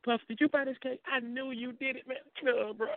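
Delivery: high-pitched mock choked-up disbelief; hammed-up touched accusation; Ramsey. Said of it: "do your impression of Ramsey".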